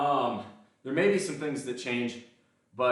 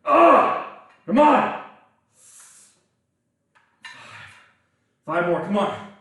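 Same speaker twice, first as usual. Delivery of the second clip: loud grunting encouragement; straining shout; 10 semitones higher, 11 dB louder, slower than usual. Real, not acted. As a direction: push through the last reps, yelling. Pushing through the final reps.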